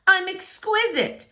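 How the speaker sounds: high, delighted self-praise; vain cry. Acted. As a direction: crow about how gorgeous you are. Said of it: "he cried".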